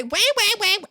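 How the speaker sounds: high, loud crybaby wail; exaggerated wah-wahs; mock whining. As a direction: mimic their whining scornfully. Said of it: "That's what you sound like to me".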